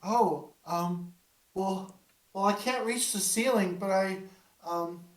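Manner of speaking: put-on high childish voice, playful; a little girl popping out to startle; eager ghost child.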